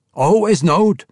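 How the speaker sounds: Always knowed, high and loud with a rising end; indignant question. Acted. Emrys echoing Bruner, indignant rising question. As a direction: throw Always knowed back in disbelief. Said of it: "Emrys demanded".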